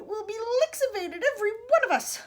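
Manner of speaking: high, loud wail of doom; anguished cry. A narrator's reading. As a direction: wail it in rising panic. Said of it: "cried Grandma Georgina".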